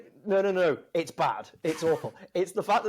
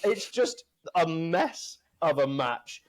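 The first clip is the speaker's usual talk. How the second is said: normal talking voice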